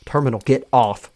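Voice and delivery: loud sharp command. Acted. Cole ordering Terminal off Harmonia, loud command. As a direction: order him off at full volume. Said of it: "Cole commands".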